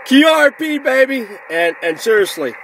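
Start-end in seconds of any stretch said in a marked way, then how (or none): 0.1-1.3 excited boast. Real, not acted. Ham proudly shouting to his viewers, excited burst. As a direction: crow about it like a proud ham. Proud of running low power.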